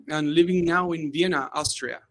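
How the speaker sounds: usual speaking voice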